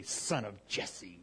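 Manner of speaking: slow, scornful sneer at the name; derisive put-down. Retold or imitated. Saul sneering at his rival's humble name, slow and derisive. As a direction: drag the name out with contempt. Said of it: "in a really put down, uh, kind of a way".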